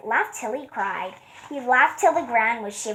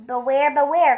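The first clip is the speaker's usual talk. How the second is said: calling out; 3 semitones higher, 6 dB louder than usual. A narrator's reading. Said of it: "called the bees to the bear".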